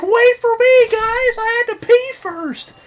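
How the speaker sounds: very high, loud mock yell; comically high-pitched frantic call; straggling enemy's voice. Straggler calling the group to wait, high mock yell. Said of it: "He's like".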